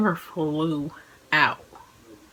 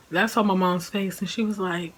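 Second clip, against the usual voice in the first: normal talking voice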